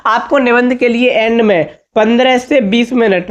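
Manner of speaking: normal talking voice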